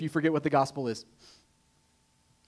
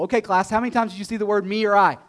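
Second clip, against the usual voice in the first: normal talking voice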